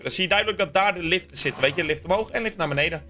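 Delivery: ordinary talk, nothing marked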